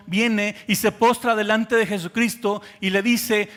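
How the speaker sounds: ordinary talk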